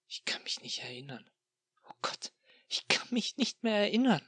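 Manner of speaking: whispered, rising to a high breathless whisper; terrified whisper of forgetting; frightened whisper. Acted. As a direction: horror dawning in a hushed voice. Despair, can't remember eating.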